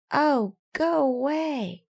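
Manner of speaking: tiny high grumpy voice, slow; a tiny creature shooing him off; small voice. Acted. Mouse in the shoe, small high and grumpy. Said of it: "said a small voice".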